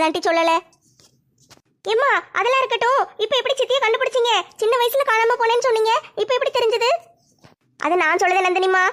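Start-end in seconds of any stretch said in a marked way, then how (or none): 1.9-6.9 put-on higher, girlish voice, confused and questioning; a bewildered teenage girl pressing her mother; puzzled daughter. Daughter questions mother about the new aunt, puzzled girlish voice.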